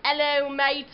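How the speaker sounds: a toned-down British "Hello, mate."; a calmer second try at British; British accent. Shown as a demonstration. Retrying the British accent for viewers, calmer British greeting. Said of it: "I can definitely do better than that".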